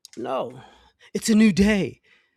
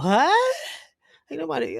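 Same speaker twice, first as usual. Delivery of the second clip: shocked what; 6 semitones higher than usual. Retold or imitated. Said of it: "She's like".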